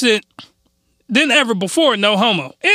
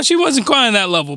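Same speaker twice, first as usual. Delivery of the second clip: ordinary delivery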